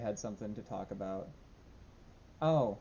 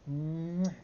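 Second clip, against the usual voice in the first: lightly raised pet voice ending in a kiss; doggy love talk; 6 semitones higher, slower than usual. Real, not acted. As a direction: tell the dog you love him. Cooing affection to the dog.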